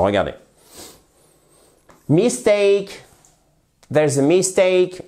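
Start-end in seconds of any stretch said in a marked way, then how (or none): 2.1-5.0 "Mistake" with the weight on "-stake", second syllable; stress on second syllable. Shown as a demonstration. Teacher showing students the stress of "mistake". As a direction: lean on the "take" of "mistake". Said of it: "l'accentuation sur la seconde syllabe".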